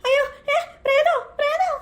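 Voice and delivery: high shrieking mock terror; hammed-up scared squeal at the ghost; startled shriek. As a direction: squeal the word in fright.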